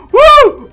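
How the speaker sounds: sudden high, loud whoop of surprise; a sharp startled cry. Real, not acted. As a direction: yelp up high and loud as if caught off guard. Enemy attack surprises him.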